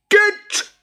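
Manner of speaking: very high, slow, loud creature shriek; furious high boggart yell. Acted. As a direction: shriek it like an angry little boggart. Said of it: "he shrieked".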